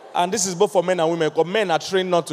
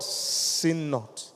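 soft answer; 4 semitones lower, 9 dB quieter than usual. Shown as a demonstration. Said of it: "Be angry and what?".

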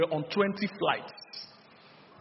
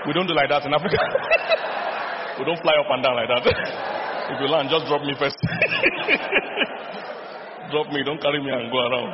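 real laughter breaking in between loud phrases; laughing; 2 semitones lower, 4 dB louder than usual. Real, not acted. Preacher joking to congregation about the landing, laughing loudly. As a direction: crack up at your own story.

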